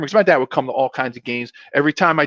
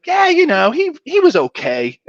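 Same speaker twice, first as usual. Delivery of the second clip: high-pitched, playful downplaying; joking nonchalance; 13 semitones higher, 3 dB louder than usual. Dad kidding quarterback about the champion, mock nonchalant. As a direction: shrug it off teasingly in a raised voice, slowing at the end.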